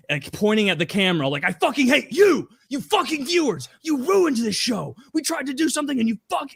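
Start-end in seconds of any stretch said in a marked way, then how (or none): 1.4-6.5 high, strained mock screaming; over-the-top meltdown. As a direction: imitate a host losing his mind at viewers. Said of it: "he's losing his mind, fucking over the top".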